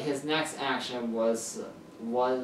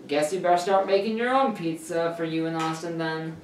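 teasing mom, a bit higher and sassy; 5 semitones higher, 4 dB louder than usual. A narrator's reading. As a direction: tease him with plenty of sass. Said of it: "much sass in her voice".

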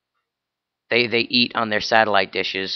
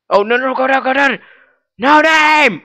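high-pitched frantic squeal; panicked yelp; 13 semitones higher, 4 dB louder than usual. Real, not acted. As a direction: shriek in alarm as it all goes wrong. Panicking at game character falling.

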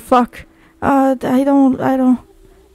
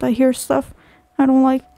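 usual speaking voice

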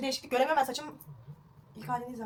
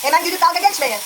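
loud, higher raised voice; shouting over noise; 6 semitones higher, 9 dB louder than usual. Real, not acted. Telling viewers not to mock, shouted over noise. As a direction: call out above the din.

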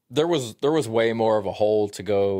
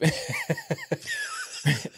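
high squealing laugh. Real, not acted. Laughs at list item.

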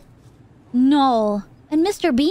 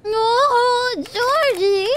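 excited call; 9 semitones higher, much slower than usual. Real, not acted. Greeting beloved character again.

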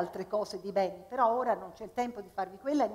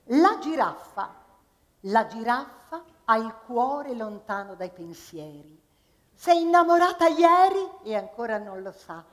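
recited with comic timing, louder; poem recital; 6 dB louder than usual. Acted. Performer reciting a comic poem to the audience. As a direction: deliver the short poem for laughs.

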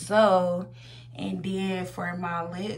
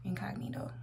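whisper; 7 dB quieter, faster than usual. Real, not acted. Checking product name, whispered.

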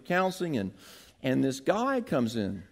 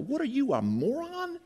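higher, quiet, half laughing disbelief; incredulous thought; 8 semitones higher, 3 dB quieter than usual. Retold or imitated. Pastor's unspoken thought about the counselee, quiet incredulous scoff. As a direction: scoff under the breath. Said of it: "but I thought".

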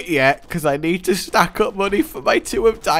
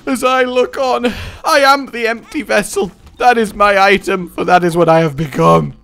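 mock grand declamation; 5 semitones higher, 6 dB louder than usual.

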